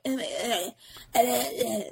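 soft, repeated whiny bleats; mock fan whining. Host mocks upset fans of a rapper, soft repeated bleating. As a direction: bleat softly like a complaining fan. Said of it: "Logic people were like".

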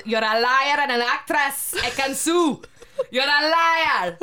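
mock outraged Italian accent; imitating Davide. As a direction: ham up the Love Island guy calling her a liar. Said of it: "and he says".

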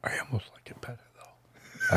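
hushed whisper, almost no voice. Real, not acted. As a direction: breathe the words in a secret whisper. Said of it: "Why are you whispering?".

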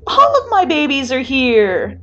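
high, loud, drawn-out delight; squealing. A narrator's reading. Greeting all the visiting kids, squealing. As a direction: squeal like a thrilled big sister. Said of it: "Cammy squeals again".

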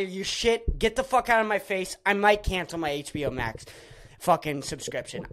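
normal talking voice

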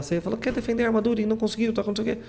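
high, fast, a bit soft, mocking a scolding girl; Athena scolding the knight, high and fast. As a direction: rattle it off in a high mock-scold. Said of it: "A Atena".

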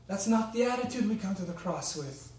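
usual speaking voice

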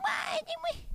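very high, soft mom voice; the mother's wistful quip; squeaky high mom impression; imitating mom. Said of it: "She went".

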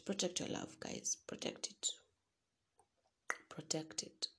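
unvoiced, fading whisper; whispered plea, fading. Real, not acted. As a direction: hush it like a tender secret. Tender advice to protect heart.

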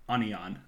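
onion said as "ah-nee-on"; odd pronunciation. Shown as a demonstration.

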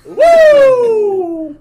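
big shout, very high; loud whoop. Real, not acted. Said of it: "Put my shoulder into that".

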